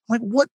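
imitating a giggle way up high, then a puzzled what; crazy high-pitched laugh. Retelling how Al Pacino cracked up on set, high mock laugh. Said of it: "This crazy high-pitched laugh".

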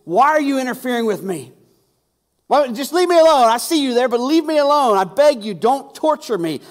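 loud, high, desperate pleading; a possessed man begging to be left alone; shrieking demon. Acted. Possessed man shrieking at Jesus, loud and high. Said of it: "with a shriek screamed".

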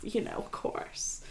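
breathy whispered aside; whispering. Retold or imitated. Beadle voiced, meek reply.

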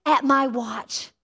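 slow, drawn-out declaration; quoted vow, said very slowly and deliberately; resolute declaration. Retold or imitated. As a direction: stretch the words out with resolve. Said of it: "but he flipped the switch".

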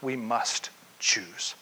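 slow, breathy "We must choose"; whispered resolve. Acted. Preacher closing the author's quote in a slow whisper. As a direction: whisper it like a solemn secret.

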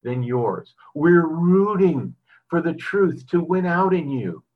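lifted pulpit reading voice; scripture reading. Acted. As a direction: read it out like a preacher's text. Reads scripture passage aloud.

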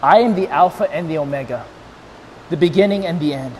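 low, measured, reverent; solemn divine declaration. Acted. Preacher quoting how God says this, solemn. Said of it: "I love how he says this".

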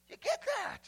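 usual speaking voice

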